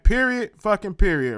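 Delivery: "Period" punched out loud; one loud, mimicked full-stop "Period"; imitated emphasis. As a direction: parrot his "Period" with force. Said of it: "He put emphasis on that shit".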